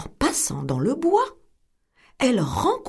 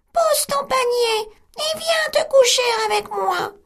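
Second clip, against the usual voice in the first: much higher, thin put-on granny voice; the wolf squeaks like grandmother; wolf in bed faking the old woman; small voice.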